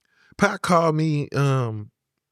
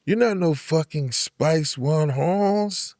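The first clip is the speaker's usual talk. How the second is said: slow, drawn-out Chicano disbelief, imitating; doubtful Chicano voice; 2 semitones higher, much slower than usual. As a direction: imitate the skeptical inmate slowly. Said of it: "And he said".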